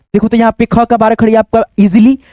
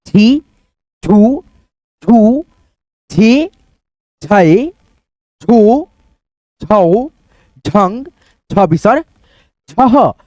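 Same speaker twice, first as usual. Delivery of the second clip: each syllable said alone with a short pause after it; syllable recitation; much slower than usual. Shown as a demonstration. Teacher chanting the barakhadi to students, one syllable at a time. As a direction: recite slowly for children to repeat.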